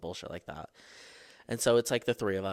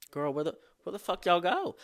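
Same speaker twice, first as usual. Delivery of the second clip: high, loud mock call for the group; where did everyone go, high and loud; 9 semitones higher, 4 dB louder than usual. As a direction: call out lost in the street. Stranded, calling out for group.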